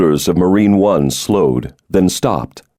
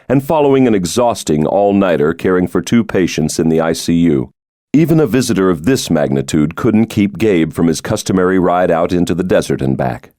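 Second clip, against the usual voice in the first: ordinary talk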